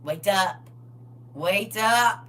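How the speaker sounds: yelling wake up. Retold or imitated. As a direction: bark it like a small child. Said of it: "yell at the top of her lungs".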